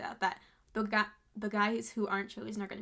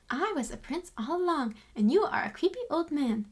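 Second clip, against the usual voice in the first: higher, hammed-up gloating declaration; mock-grand comeback; triumphant prince; 5 semitones higher, 5 dB louder than usual. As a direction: proclaim it like a sassy royal putting someone down. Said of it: "That's what he was like".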